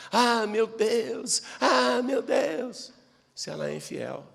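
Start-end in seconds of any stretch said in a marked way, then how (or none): 0.1-2.9 slow, drawn-out mock wail; mock lament. Imitating an unfaithful person pleading to God, mock lament. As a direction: imitate someone crying to God.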